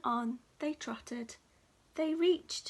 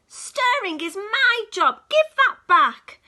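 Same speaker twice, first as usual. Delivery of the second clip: higher, louder squirrel protest; squeaky demand; 7 semitones higher, 14 dB louder than usual. Acted. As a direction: insist shrilly as the small squirrel. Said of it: "squeaked the squirrel".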